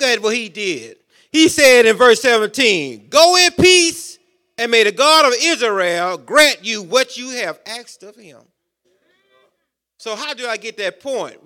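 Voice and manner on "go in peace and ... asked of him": bestow the blessing with full weight; solemn blessing. Acted. Preacher voicing Eli's blessing.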